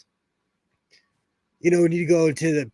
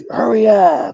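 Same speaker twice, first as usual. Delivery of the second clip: loud high-pitched "Hurry up"; mock shout; 7 semitones higher, 9 dB louder than usual. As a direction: bark it out like a fed-up friend. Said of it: "I like saying".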